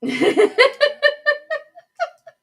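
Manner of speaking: shrieking laugh, high and loud; real high-pitched laughter; high burst of laughter. Real, not acted. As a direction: let a giddy laugh burst out. Laughs at her own comment.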